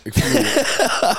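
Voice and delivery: slow, high, drawn-out mock voice; parodying an empty inspirational quote; mock slow drawn-out delivery. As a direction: stretch each word out like a cheesy slogan. Mocking a slogan.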